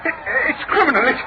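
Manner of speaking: a cornered man losing his nerve; rising panic. Acted. Preston pleads with Blake about the gang, frightened.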